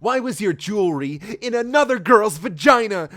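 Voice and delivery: mock scream. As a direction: scream it in melodrama. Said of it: "screamed Sonic".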